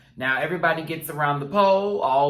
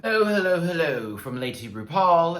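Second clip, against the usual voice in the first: mock British [name] greeting; 4 semitones higher, 4 dB louder than usual. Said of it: "give her best British accent".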